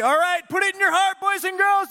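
high-pitched rallying instruction; kids' leader hype. Retold or imitated. Leader telling boys and girls what to do, high excitement. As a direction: urge the children on in a high voice.

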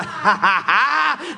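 loud, deliberate taunting laugh; mock laugh. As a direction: spell out each ha at full volume. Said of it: "You need to pass through going".

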